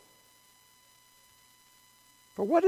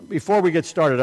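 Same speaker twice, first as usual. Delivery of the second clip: ordinary delivery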